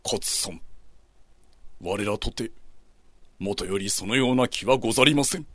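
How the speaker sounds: low, formal samurai reply; samurai lord voice. Acted. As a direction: answer gravely like a samurai lord. Saigo voiced in story.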